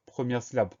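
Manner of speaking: normal talking voice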